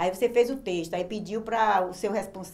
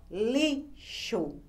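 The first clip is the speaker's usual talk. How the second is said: "lixo" very slowly as li, pause, xo; separated syllables. Shown as a demonstration. Teacher demonstrating the syllable exercise, split syllables. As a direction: break "lixo" cleanly in two. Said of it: "Separe as sílabas das palavras".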